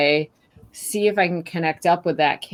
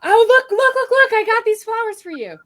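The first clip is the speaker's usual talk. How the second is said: put-on much higher and louder, bursting kid voice; excited kid; 7 dB louder than usual. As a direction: squeal it like a thrilled little kid. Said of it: "So they burst through the door".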